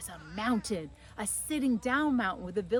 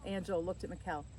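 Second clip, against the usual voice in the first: ordinary delivery, nothing marked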